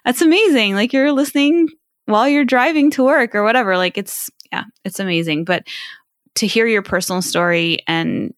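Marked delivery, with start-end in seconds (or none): 0.0-3.8 louder and higher, thrilled; delighted reaction. Retold or imitated. Host gushing at a listener's photo, excited. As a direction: gush over a listener's dashboard photo. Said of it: "And I'm like".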